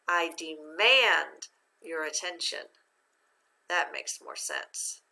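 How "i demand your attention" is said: slow phrase with demand stressed on its second syllable. Shown as a demonstration. Teacher shows the word in a sentence, demand accented on MAND. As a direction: lean hard on the mand of demand. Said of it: "That's how you say".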